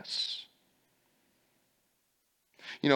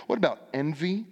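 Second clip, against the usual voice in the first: normal talking voice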